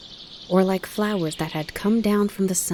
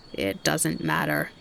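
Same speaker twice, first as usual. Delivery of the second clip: rasped; 4 dB quieter, slower than usual. Acted. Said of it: "the grasshopper rasped".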